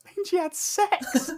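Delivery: high, slow, loud put-on voice; imitated blunt reveal. Dad learning what the son's note means, high mock astonishment.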